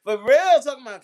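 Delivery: ordinary talk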